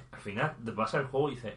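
usual speaking voice

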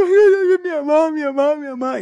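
high, loud hammed-up bawling; mock crying. Imitating the friend in the passing patrol, mock sobbing for his mother. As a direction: bawl like a crybaby, loud at first then easing. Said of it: "iba llorando, llorando".

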